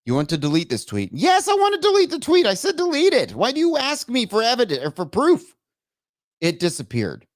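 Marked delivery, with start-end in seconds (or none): 1.1-5.5 high-pitched snapping frustration; exasperated rant. Real, not acted. Streamer answering a delete prompt, exasperated. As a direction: argue with the machine, pitch way up.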